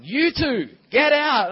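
loud, high "get out"; a teacher shouting two kids out of class; shouted order. Retold or imitated. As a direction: bark them out of the room like a cross teacher.

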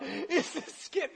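high, slow, sobbed-out words; mock tearful. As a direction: squeeze it out through pretend sobs. Explains himself while crying, retold.

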